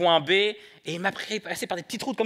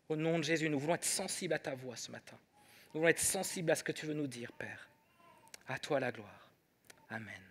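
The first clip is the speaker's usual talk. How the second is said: earnest prayer; 2 semitones lower, 6 dB quieter than usual. Real, not acted. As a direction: pray quietly with the congregation. Leads the congregation in prayer.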